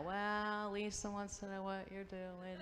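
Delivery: high, put-on go-between voice; mock coy answer. Go-between to Dave on her friend's behalf, mock-coy.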